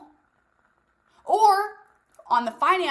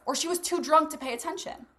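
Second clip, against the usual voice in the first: normal talking voice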